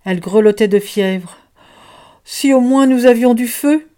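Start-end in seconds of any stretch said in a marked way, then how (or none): 2.3-3.9 higher, louder pleading wish; a child wishing aloud for fire; worried child. Acted. Child fretting over the sick girl, higher and louder. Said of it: "dit un enfant".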